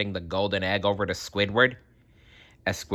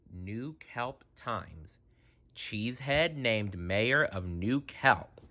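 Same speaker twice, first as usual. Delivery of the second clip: quiet reading; 8 dB quieter, slower than usual. A narrator's reading. Reading newspaper headline aloud.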